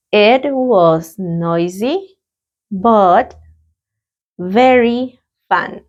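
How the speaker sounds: very slow repetition; a calm, drawn-out repetition of the answer; slow repeat. Shown as a demonstration. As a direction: stretch every word for learners. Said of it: "Let's practice the pronunciation".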